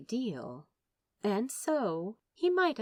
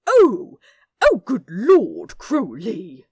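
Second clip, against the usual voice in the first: mock outrage; 3 semitones higher, 16 dB louder, slower than usual. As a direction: cry out in pretend indignation. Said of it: "cried out in mock outrage".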